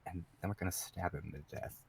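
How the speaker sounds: low, hushed menace; really quiet. Acted. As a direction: drop right down, very soft and low. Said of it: "he gets really quiet".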